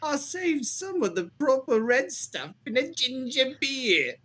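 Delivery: reading a killer's letter in a hammed-up British accent; jolly British accent. Reading the Ripper letter to friends, in a hammed-up British accent. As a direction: lay on a jolly British accent, pausing as you go. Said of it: "when you were doing the accent".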